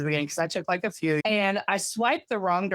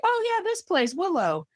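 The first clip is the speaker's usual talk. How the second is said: much higher, bright, eager suggestion; excited recognition; 12 semitones higher than usual. Retold or imitated. Friend naming the bar, eager recognition. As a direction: chirp it out like she just remembered.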